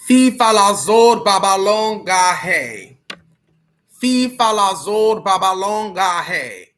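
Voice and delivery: booming ritual chant; loud incantation. Acted. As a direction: chant the spell out loud and proud.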